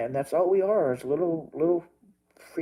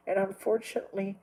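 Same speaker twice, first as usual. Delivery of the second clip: ordinary talk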